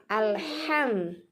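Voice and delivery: "Alham" slowly with a light throat h; small ha. Shown as a demonstration. Teacher repeats for students, "Alham" with careful small ha. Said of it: "Hanya ha kecil".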